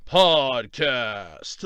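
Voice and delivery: deep slow announcer read; big booming show-intro voice. Acted. Spoken podcast title intro.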